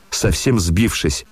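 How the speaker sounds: usual speaking voice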